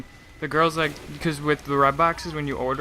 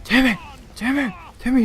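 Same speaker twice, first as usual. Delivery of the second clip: put-on much higher and louder, calling out for a lost kid; imitating yelling a name; 10 semitones higher, 4 dB louder than usual. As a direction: call across the store for your missing son. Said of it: "the guy just starts yelling".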